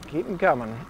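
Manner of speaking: ordinary talk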